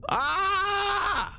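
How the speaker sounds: sudden high yelp; startled high shout. Real, not acted. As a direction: shriek in alarm.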